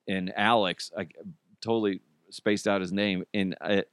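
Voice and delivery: ordinary delivery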